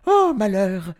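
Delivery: high, loud lament; dismayed wail. A narrator's reading. Professor realising the paint spilled, wailing. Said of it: "soupira-t-il".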